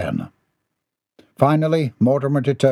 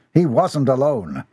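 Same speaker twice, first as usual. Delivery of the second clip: ordinary delivery, nothing marked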